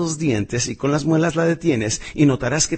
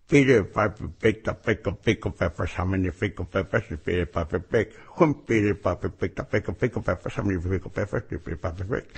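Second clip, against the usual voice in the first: tongue twister mumbled around a pen held in the teeth, softer; pen in mouth; 6 semitones lower, 4 dB quieter than usual. Shown as a demonstration. Speech coach showing the pen drill, muffled by the pen. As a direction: talk with a pen clamped between the molars. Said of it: "Te pones la pluma en la boca y empiezas".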